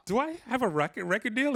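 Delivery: high-pitched mock bafflement; retold pointed disbelief; incredulous. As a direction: ask it as if doubting the obvious. Retelling his disbelief at contest.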